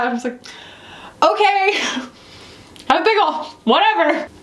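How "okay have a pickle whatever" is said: high, fast, giving up with a laugh; laughing resignation. Retold or imitated. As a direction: throw up your hands, laughing.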